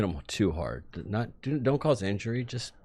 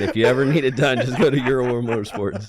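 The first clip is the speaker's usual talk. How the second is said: louder, announcer-style shop plug; mock ad plug; 6 semitones higher, 11 dB louder, faster than usual. Host plugging the repair shop to listeners, loud mock ad read. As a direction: sell it like a radio ad.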